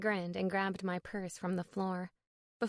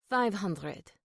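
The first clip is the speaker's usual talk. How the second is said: put-on firm French accent; name the price in a French accent; 5 dB louder than usual. A narrator's reading. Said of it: "his thick French accent".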